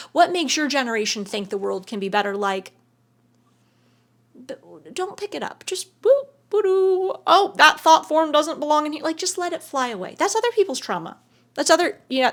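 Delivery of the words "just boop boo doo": mock boop noise. Flicking away a thought form.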